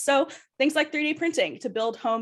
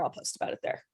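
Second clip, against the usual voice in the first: tossed-off whisper, almost under the breath; whispered aside; 10 dB quieter, faster than usual. Real, not acted. Streamer whispering a quick aside to chat, hushed. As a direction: drop it to a whisper as an afterthought.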